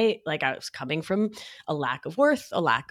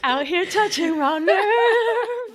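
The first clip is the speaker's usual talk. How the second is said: laughing; 12 semitones higher, 8 dB louder, much slower than usual. Real, not acted. Laughing after touching a nerve.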